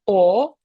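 «о» as a French nasal vowel, loud; nasal o demo. Shown as a demonstration. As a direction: hum the «о» through the nose. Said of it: "і тоді ми кажемо". (носовий «о» голосно)

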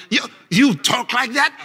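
ordinary talk

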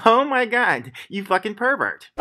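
very high, slow-drawn mock outrage; outraged woman imitation. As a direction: mimic a scandalized woman, stretching it out. Imitates woman's outraged reaction again.

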